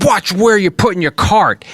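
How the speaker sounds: high, slow, emphatic mock outburst; angry retold shout. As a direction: imitate an irate shopper telling someone off. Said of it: "he was so angry".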